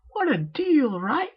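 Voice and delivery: very high, bubbly cartoon pony; high and loud, childlike insistence; Pinkie Pie voice. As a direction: squeak it out like a hyper party pony.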